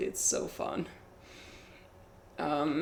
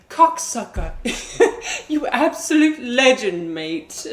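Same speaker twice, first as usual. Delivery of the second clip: laughing, higher British voice; mock British accent; 6 semitones higher, 8 dB louder, slower than usual. Retelling how a British viewer greets chatters, in mock British accent. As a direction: imitate a cheery British bloke. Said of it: "he'd be like".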